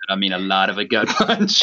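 breaking into a real laugh. Real, not acted. Host joking with colleagues about a hard-hitting book, laughing. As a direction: let a laugh bubble up through the words. Laughs while stressing the gut punch.

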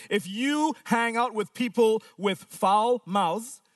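usual speaking voice

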